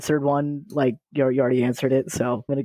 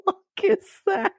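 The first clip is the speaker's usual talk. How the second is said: shocked. Real, not acted. Shocked reaction to Jeff.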